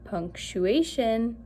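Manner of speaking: slow teaching emphasis; slow, drawn-out word. Shown as a demonstration. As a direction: stretch out punctuation slowly. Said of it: "I hope you said".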